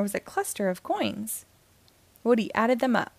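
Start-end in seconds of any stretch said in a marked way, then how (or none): none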